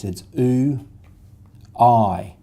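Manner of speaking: teacher modelling vowel sounds; sounding out vowels. Shown as a demonstration. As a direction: sound out oo then I clearly. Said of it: "two vowel sounds".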